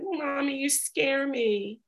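a hint of a small child saying it gently; mild imitation of a young daughter; little daughter. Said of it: "And she said".

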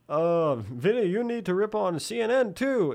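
mock commenter. Voicing a commenter.

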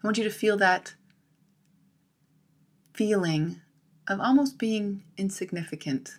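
soft, measured meditation voice with long pauses; calm, unhurried relaxation-exercise delivery; guided visualization. Acted. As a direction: guide them gently into letting go, phrase by phrase.

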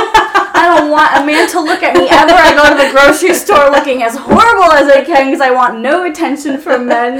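loud, high, giggling self-mockery; laughing exaggeration. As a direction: overstate it for the joke, cracking up. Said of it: "I'm like".

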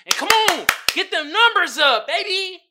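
loud, high hype call; rally the viewers loudly. Real, not acted. Vlogger urging his tubers to boost numbers, loud hype.

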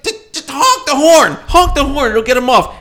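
imitated frantic instruction from the passenger seat; urgent mother. Mother urging Bill to honk.

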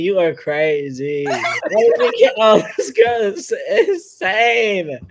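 high, loud, laughing through the words; laughing disbelief. Retold or imitated. Woman imagines her thoughts when a stranger introduces himself, laughing loudly. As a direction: laugh at how crazy it is. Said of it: "I would be thinking".

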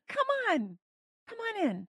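excited call. Acted. Pleading invitation from God.

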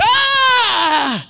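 high loud mock scream of pain; imitating dad's pain scream; retold scream. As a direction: let out a high yelping cry of pain. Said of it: "I've never heard my dad scream".

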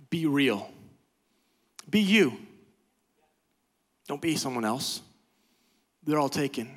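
normal talking voice

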